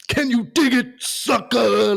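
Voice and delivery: hammed-up Booker T shout; loud Booker T catchphrase. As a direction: bellow it like a wrestling promo. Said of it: "proper loud in your face".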